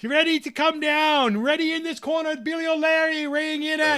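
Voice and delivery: high, loud, drawn-out fight announcer; ring announcer. Retold or imitated. Game master voices the wrestling announcer to the crowd, booming. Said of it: "the announcer going".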